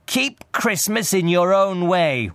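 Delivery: high, slow, forceful rebuke; stern. A narrator's reading. Uncle dismisses his nephew, stern and slow. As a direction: lay it down sternly, word by word. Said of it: "returned the uncle sternly".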